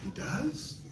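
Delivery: high, gasping mock surprise. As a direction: gasp and act amazed, high. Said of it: "You're online like".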